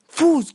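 high, loud whoosh of a car; imitated whoosh. Imitating a patrol car passing, sudden loud whoosh. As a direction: burst out a quick "fus".

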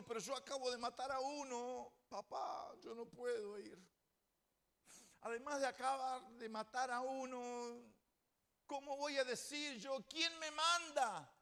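high, whiny plea of a reluctant man; pleading excuses. Acted. Moses protesting to God, high and whining. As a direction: beg off the task like a scared son.

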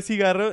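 cigarro with a strong trilled rr; rolled R demo. Shown as a demonstration. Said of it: "R con R".

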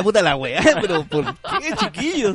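"por qué, chiquillos" agudo y riendo; queja exasperada. Retold or imitated. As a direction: queja entre risas, exasperado. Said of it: "y dice". (queja con risa)

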